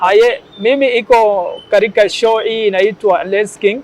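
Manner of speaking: put-on Swahili with a Somali accent; upbeat Somali-accented Swahili presenter; Them Somali accent. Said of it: "imitate them Somali guy".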